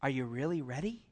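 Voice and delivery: low, soft tempting voice; a sly inner voice murmuring doubts; sinful nature. Acted. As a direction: voice the doubting flesh, hushed and low.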